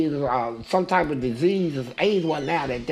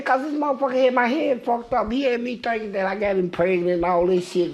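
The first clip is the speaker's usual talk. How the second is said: ordinary delivery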